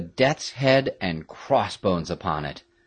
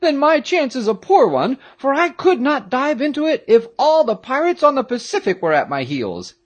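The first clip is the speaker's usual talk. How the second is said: put-on high-pitched, loud frightened boy; loud, high character voice full of dread; dismayed Peterkin cry; 5 dB louder than usual. A narrator's reading. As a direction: protest in a high, alarmed voice. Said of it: "cried Peterkin".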